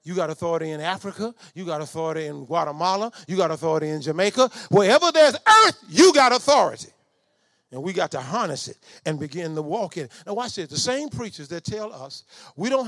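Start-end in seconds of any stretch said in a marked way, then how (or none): 4.7-6.9 preaching climax. Real, not acted. Preacher telling the saints they have authority, loud climax. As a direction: drive the point home to the congregation.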